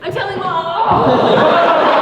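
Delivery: loud, drawn-out tattletale wail; whiny tattling little sibling. Acted. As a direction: yell it like a kid running to mom. Kid tattling after losing fight.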